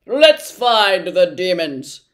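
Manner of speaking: loud, slow pompous bluster; rally the troops like a braggart; blustering humbug. Acted. The humbug urges the others on, loud.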